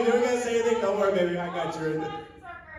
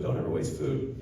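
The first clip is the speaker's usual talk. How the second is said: whisper; 7 dB quieter than usual. Retold or imitated. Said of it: "God said".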